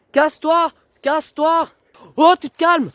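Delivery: high, loud barked orders; shouting match. Real, not acted. Girlfriend and boyfriend shout at each other, furious. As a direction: yell to get rid of him.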